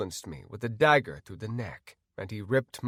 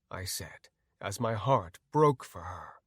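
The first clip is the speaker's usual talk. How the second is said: usual speaking voice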